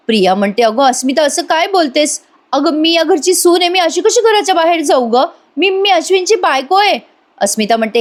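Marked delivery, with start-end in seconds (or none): 0.6-7.1 higher and higher, imitating a desperate plea; mock tearful begging, climbing in pitch; pleading wife. Priya begs Asmita not to throw her out, rising mock plea.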